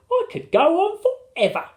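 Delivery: very high, exultant lady; wife's voice. A narrator's reading. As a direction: sing out the words with delight. Wife's blissful answer.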